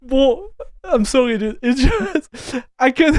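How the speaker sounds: high, tearful, words broken by laughter; laughing apology. Real, not acted. Streamer apologizing to chat through laughter. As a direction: apologize while you can't stop laughing. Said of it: "I'm just crying right now".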